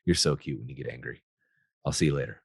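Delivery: soft, low, teasing affection; husband answers the complaint softly, tender; affectionate. Shown as a demonstration. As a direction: brush her off gently with love. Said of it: "I just laugh".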